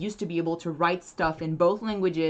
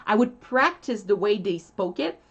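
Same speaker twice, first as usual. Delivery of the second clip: normal talking voice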